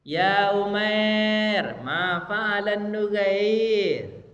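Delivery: slow, drawn-out tender teasing call; the Prophet teasing. Retold or imitated. Prophet gently teases the boy about his dead bird, slow and drawn out. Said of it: "Misalnya gini".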